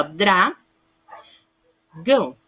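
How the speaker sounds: dra and gão set apart by a long pause; demonstrated cluster. Shown as a demonstration. Teacher asking pupils to feel the cluster, syllable by syllable. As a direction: separate dra from gão, letting D and R sound. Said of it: "Vocês conseguem sentir".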